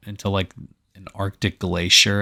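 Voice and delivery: ordinary talk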